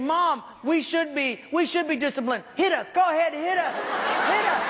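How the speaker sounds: put-on a boy hamming up a plea to be punished; mock pleading brother. Brother begging mom for discipline.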